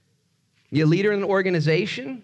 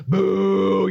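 louder, higher, drawn-out jeer; mock booing; 4 semitones higher, 5 dB louder than usual. Preacher jeering a job gone bad, playful booing. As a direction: boo it like a let-down crowd.

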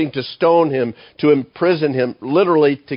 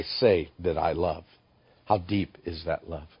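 drops to a much softer, hushed plea; quiet sincere; 6 semitones lower, 7 dB quieter than usual. Real, not acted. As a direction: sink to a near-hush, asking from the heart. Quiet heartfelt searching question.

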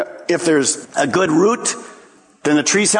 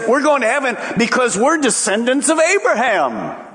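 smug boast; 7 semitones higher than usual. Retold or imitated. Pharisees boasting of heaven.

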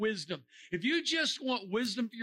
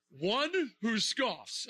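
slow mock definition; much slower than usual. Shown as a demonstration. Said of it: "What is a scoffer?".

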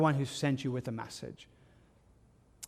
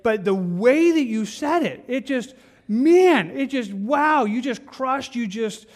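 much higher and louder, stretched-out dismay; exasperated exclaim; 8 semitones higher, 7 dB louder, slower than usual. Retold or imitated. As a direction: cry out man and wow in dismay. Acting out hurt reaction.

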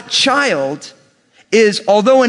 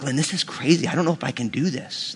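murmured, much softer aside to his wife; quiet, lower worried murmur; hushed aside; 5 semitones lower, 9 dB quieter than usual. Retold or imitated. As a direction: drop low and soft, overwhelmed. Dad overwhelmed, murmuring to wife.

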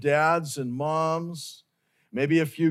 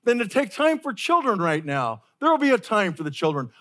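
higher, brushing-off voice of the disciples; dismissive disciples; 6 semitones higher than usual. Retold or imitated. Preacher voicing disciples brushing parents off, mildly impatient. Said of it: "Maybe they could be saying".

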